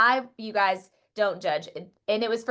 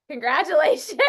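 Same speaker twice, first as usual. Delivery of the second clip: through-the-teeth fake cheer, higher and louder; forced congratulations; 8 semitones higher, 5 dB louder than usual. Grudging mock cheer. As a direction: fake congrats while clapping for the winning bidder, grudging. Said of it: "so mad".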